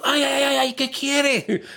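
high, slow pained whine; whiny high voice. Acted. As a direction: moan from inside the pot. Pablo in the pot, whining. (quejido agudo y lento)

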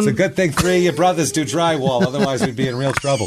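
high, mocking Boston housewife voice; New England accent. Wife needling husband about the drywall, in a high mock New England accent. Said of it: "yelling at each other in a heavy New England accent".

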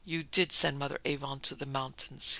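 usual speaking voice

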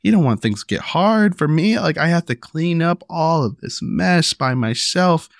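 put-on gentle explaining to child. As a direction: patiently talk a small kid into cleaning up. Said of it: "I'll say".

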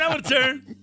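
high, eager repeated plea; eager begging. Retold or imitated. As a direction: keep begging for your turn, childlike. Passengers eager to sit on the man.

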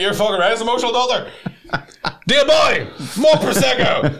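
high, hammed-up wailing shout; mock emotional outburst. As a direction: cry out for a drink, overwrought. Acted tearful outburst at the theatre.

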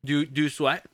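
talking while munching; words round a nut; mouth full. Real, not acted. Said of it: "Sorry, I'm munching".

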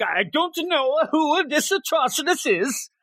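slow, high, innocent put-on voice of a disguised villain; hammed-up fake ignorance; playing dumb. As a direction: feign innocence with a silly cover voice.

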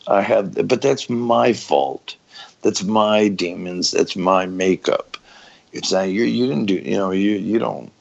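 ordinary talk

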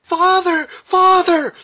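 very high, loud scream for father; shrieked, drawn-out call; piercing cry. A narrator's reading. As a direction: scream out desperately for rescue. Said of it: "exclaimed a piercing cry".

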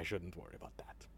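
soft unvoiced reassurance; whispering. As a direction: whisper it close. GM whispers during stealth mission.

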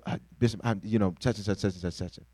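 hint of a sheepish caller; apologetic caller. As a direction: mumble an apology over the phone. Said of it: "they call".

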